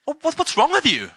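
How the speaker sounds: shocked outburst. Retold or imitated. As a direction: recoil as if bumped into out of nowhere. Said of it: "And she's like".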